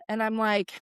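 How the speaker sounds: ordinary talk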